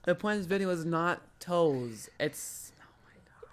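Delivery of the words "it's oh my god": exasperated whisper. Real, not acted. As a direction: breathe it out in despair.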